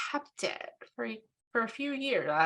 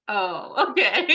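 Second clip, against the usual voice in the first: laughing mock reply; 5 dB louder, slower than usual. Teacher imitating a student's reply to empty praise, laughing. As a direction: laugh your way through an awkward acknowledgement.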